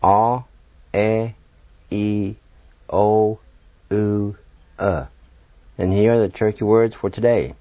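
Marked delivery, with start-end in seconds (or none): 0.0-5.0 A, E, I, O, U, E as separate held Cherokee vowels; A as "ah", E as "eh", I as "ee", each drawn out alone; isolated vowel sounds. Shown as a demonstration. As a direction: sound out A, E, I, O, U, E one by one, pausing between. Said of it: "here are the six Turkey vowel sounds".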